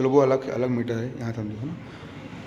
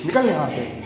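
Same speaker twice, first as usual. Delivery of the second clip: higher-pitched mock scolding, no real volume; mock yelling; 5 semitones higher than usual. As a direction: act out rudely shooing someone away. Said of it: "तुम दूसरे को चिल्ला रहे हो". (चिल्लाने की हल्की नकल)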